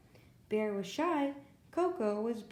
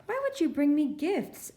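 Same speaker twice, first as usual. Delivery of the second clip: higher and louder wary rabbit; nervous little rabbit, higher and louder; suspicious Rabbit; 6 semitones higher, 5 dB louder than usual. A narrator's reading. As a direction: pull back from strangers with gifts.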